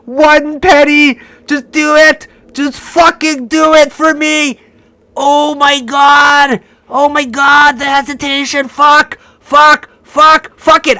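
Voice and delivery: high, loud, exasperated curses; frustrated shouting. Real, not acted. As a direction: snap with rising frustration. One penny from finishing.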